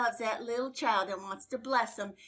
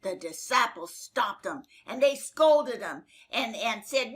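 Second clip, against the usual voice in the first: ordinary delivery, nothing marked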